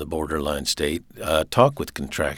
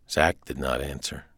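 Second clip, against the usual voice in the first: plain reading voice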